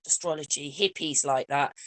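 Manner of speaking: dismissive society voice. Retold or imitated. Imitating society warning people off astrology, mildly dismissive. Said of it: "you know how they say".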